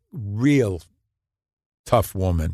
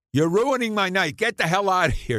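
imitating an annoyed old woman, pitched higher; mock angry retort; 10 semitones higher than usual. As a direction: snap at the stranger at the door, half-playful. Woman scolds the doorbell caller.

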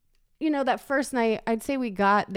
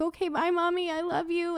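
put-on child voice; 8 semitones higher, 3 dB quieter than usual. Son signs off, voiced.

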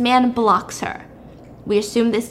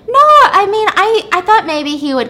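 flustered high; 9 semitones higher, 7 dB louder than usual. Retold or imitated. Flustered reply.

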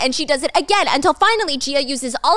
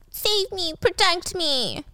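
mock damsel; 7 semitones higher, 4 dB quieter, slower than usual. Mocking Stacy as helpless.

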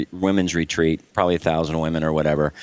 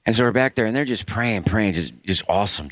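usual speaking voice

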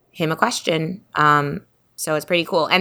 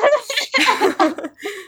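high burst of laughter; squealing giggle. Real, not acted. Laughing at spirit story.

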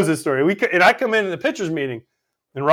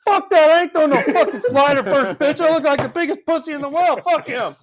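mock angry pitcher; 9 semitones higher than usual. Pitcher refusing the manager's slider call, as a high hammed-up rant. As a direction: play the pitcher blowing up at the idea. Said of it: "He's like".

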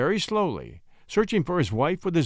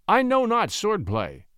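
embarrassed confession; 6 semitones higher, slower than usual. A narrator's reading. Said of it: "He flushed to make the confession".

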